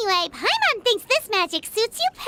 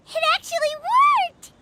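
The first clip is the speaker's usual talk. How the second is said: excited; 7 semitones higher than usual. Real, not acted. Magic succeeds at last.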